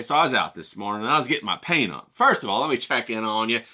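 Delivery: ordinary delivery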